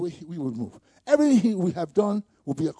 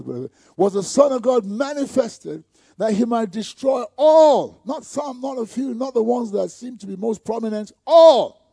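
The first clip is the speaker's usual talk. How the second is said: preach; 5 semitones higher, 6 dB louder than usual. Acted. Preaching at full force.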